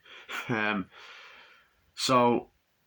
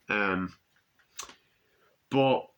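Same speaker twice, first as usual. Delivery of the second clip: usual speaking voice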